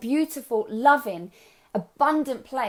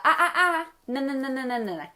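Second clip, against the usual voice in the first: high, playful telling-off; teasing reprimand, starting high and dropping; mock scolding; 4 semitones higher than usual. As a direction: cut them off with a playful no-no. Coach mock-scolds a negative client.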